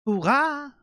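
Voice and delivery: high cheer in a put-on voice; mock cheer. Viewer cheering along with the show, excited. Reacting to anime dub line.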